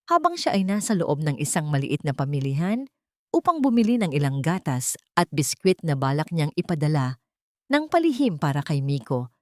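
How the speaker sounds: usual speaking voice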